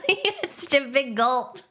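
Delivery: talking through helpless laughter; cracked up and giggling; laughing. Real, not acted. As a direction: squeeze the words out between laughs. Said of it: "I'm sorry for laughing".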